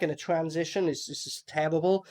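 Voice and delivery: usual speaking voice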